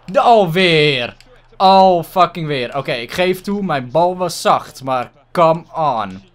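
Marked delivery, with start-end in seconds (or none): none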